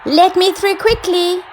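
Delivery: high, loud demand; queen demanding to get through the crowd. Acted. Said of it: "the voice demanded".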